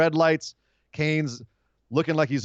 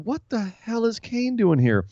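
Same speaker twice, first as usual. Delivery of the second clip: higher, slow baffled disbelief; 8 semitones higher, slower than usual. Retold or imitated. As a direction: drag it out puzzled. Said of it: "we were all sitting there going".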